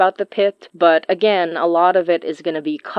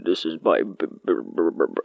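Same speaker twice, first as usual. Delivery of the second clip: very low growling wolf voice; werewolf voice; 12 semitones lower, 6 dB quieter, faster than usual. Shown as a demonstration. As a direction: go as low as you can, growling. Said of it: "I'm just going as low as I possibly can".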